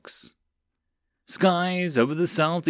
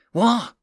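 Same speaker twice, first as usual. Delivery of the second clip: startled hiss; 10 semitones higher, 5 dB louder than usual. Acted. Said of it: "Kagar hissed".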